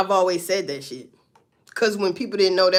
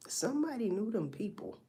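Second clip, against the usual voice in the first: quiet repeat; 11 dB quieter than usual. Shown as a demonstration. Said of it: "repeated for emphasis".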